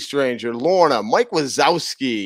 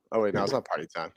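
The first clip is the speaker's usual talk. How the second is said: fast, soft retraction; fast correction; 6 semitones lower, 11 dB quieter, much faster than usual. Pretend. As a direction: rush it out sheepishly. Catching himself, quick correction.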